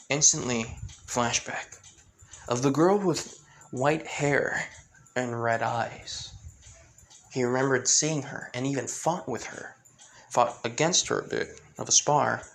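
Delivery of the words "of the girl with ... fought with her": plain reading voice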